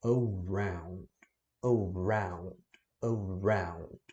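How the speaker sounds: "around" slow, closing to "au"; slowly shape "around" as in a mirror; mirror drill. Shown as a demonstration. Teacher recalling students' mirror practice of "around". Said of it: "no espelho".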